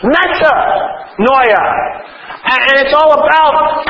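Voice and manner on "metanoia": Metanoia spoken very slowly, each syllable stretched; emphatic slow Metanoia; drawn out slowly. Shown as a demonstration. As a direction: linger on Metanoia, savoring it. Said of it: "The focus of metanoia is on the after".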